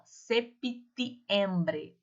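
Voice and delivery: very slow "septiembre" with the p sounded. Shown as a demonstration. Teacher models the p slowly for the class. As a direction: drag out "septiembre", syllable by syllable. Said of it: "O P, ele é mudo, mas eu pronuncio".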